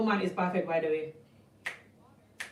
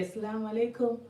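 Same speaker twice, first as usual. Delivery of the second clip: mock-obedient greetings, a little softer; demure wife; 5 dB quieter than usual. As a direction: play the meek, careful wife. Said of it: "talk slow. Just only say".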